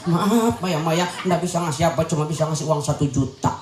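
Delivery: acted low, subdued pleading to a mother; pleading apology. Child apologising to mother over a small gift, low pleading. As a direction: beg forgiveness like a contrite child.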